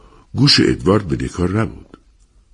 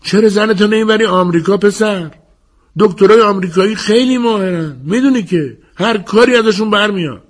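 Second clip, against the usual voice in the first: high-pitched raised voice; shouting advice; 4 dB louder than usual. A narrator's reading. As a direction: call out to him, pitched way up. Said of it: "یک بار رو به او داد زد". (با صدای زیر و بلند، داد زدن)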